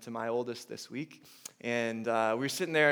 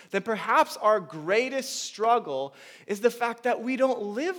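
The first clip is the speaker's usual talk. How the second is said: rising preaching; 7 semitones higher, 7 dB louder than usual. Acted. Preaches at full force.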